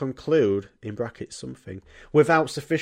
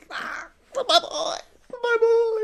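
very high, slow crying wail; grieving dad wail; slower than usual. Acted. Retelling the film scene, the dad wails high over his boy. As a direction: sob it out high and drawn out. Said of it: "Dumbledore's there, and he's just going".

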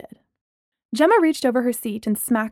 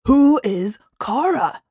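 slow, louder demand; 3 semitones higher, 5 dB louder, slower than usual. A narrator's reading. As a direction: demand the answer. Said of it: "Braylon demanded".

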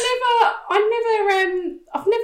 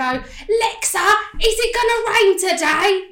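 put-on a light Cockney chimney sweep accent, lifted higher; 7 semitones higher, 4 dB louder, slower than usual. Rebel asking Alexa about rain in Cockney chimney sweep accent. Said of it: "like, chimney sweep accent".

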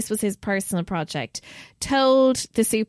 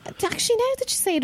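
much higher, dawning surprise; sudden realisation; 14 semitones higher, faster than usual. Real, not acted. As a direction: squeak it out as the idea hits you. Sudden realisation about graveyards.

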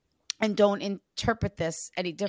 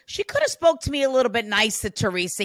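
loud, quick good-humored grumble; relayed teasing complaint, pushed louder; mock complaint; 3 semitones higher, 7 dB louder, faster than usual. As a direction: gripe playfully on someone's behalf.

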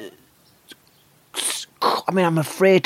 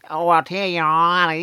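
screamy Australian accent; 4 semitones higher than usual. Shown as a demonstration. Comic letting the accent go, Australian turning into long mock scream. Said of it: "it's an inch away from screaming".

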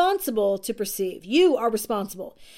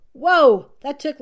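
usual speaking voice

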